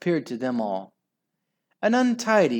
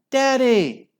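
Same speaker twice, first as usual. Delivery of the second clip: much higher, louder glad cry; children crying out; 12 semitones higher, 8 dB louder than usual. Acted. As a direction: shout with relief. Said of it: "cried the nine mouskins".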